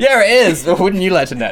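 teasing, laughing, high and loud; laughing mock coy reply. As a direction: giggle through a coy tease. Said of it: "You're like".

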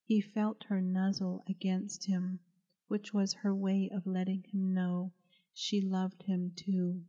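plain reading voice

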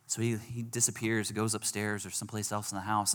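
normal talking voice

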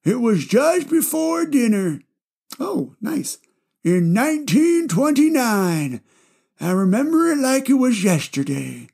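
put-on high, wobbly old man; grandpa voice. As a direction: play a rambling old grandpa. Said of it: "I just love doing my grandpa voice".

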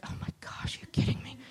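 breathy, hushed disbelief; stunned under-the-breath aside; whispered dismay. Retold or imitated. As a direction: whisper it like a private thought of dread. Acted dismay at a big book.

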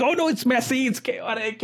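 high, fretful imitation of a flustered founder; mock panicked fellow. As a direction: squeak it out like someone overwhelmed. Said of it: "you're like".

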